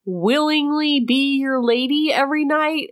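high, slow swooning lady voice; mock damsel. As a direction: play the swooning heroine for laughs. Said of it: "and she said".

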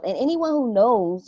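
ordinary talk, nothing marked

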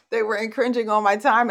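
amused laugh while repeating it. Real, not acted. Retelling pushy church members to her audience, laughing.

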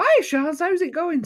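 high, loud cheerful hello; a star greeting an old friend; lightly voiced famous friend; friendly celebrity greeting. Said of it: "I'll go".

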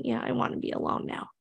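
soft, breathy calm reply; whispered calm reply. Retold or imitated. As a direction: answer gently, barely voiced. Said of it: "And he'll calmly say".

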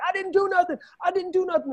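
very high mock-frantic protest; high-pitched retold scream; imitated screaming. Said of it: "he's like, I scream".

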